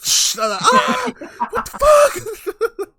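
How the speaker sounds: very high, loud, drawn-out shriek; hammed-up screech of outrage; mock pained scream. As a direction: scream it like you've been sprayed in the eye. Acting out being sprayed in the eye.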